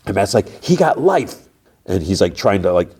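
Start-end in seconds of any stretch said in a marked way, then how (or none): 0.6-1.5 high, strained mock near-crying; pitched-up outburst, imitating someone fighting tears; holding back tears. Man answering his accuser, imitated holding back tears. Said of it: "hold in the tears".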